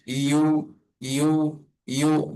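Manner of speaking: ইউ glided gently and drawn out; soft, stretched ইউ; as soft as possible. Shown as a demonstration. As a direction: ease slowly into ইউ with no hard attack. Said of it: "যত নরম করে উচ্চারণ করা যায়".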